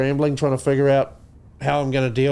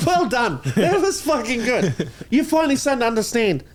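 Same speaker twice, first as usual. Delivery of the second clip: laughing; 6 semitones higher than usual. Real, not acted. Laughing praise.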